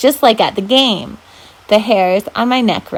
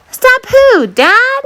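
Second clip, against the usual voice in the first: confused; 6 dB louder, much slower than usual. Acted. Confused brother.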